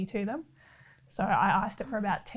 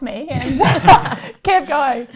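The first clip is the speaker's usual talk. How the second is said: laughing while telling it, louder; 4 semitones higher, 11 dB louder, slower than usual. Real, not acted. Laughing as she tells how the car hit her. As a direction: chuckle through the words. Laughing about foot being run over.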